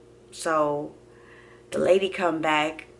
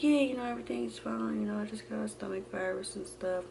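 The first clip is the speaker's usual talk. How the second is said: higher, soft, hurried-off reassurance; retold dodging, softly playing it down; evasive; 5 semitones higher, 8 dB quieter than usual. Daughter hiding pregnancy from sister, evasive. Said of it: "Trying not to really talk to 'em".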